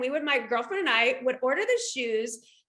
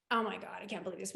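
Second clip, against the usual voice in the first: soft, quick, stunned murmur; hushed disbelief; 6 semitones lower, 11 dB quieter, much faster than usual. Retold or imitated. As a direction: murmur it in quiet amazement. Overwhelmed by friend's invitation.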